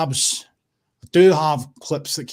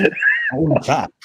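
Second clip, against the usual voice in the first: much higher, slow hammed-up swagger; mock boast; 6 dB louder, much slower than usual. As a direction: puff yourself up, jokingly. Mock boast to stream chat.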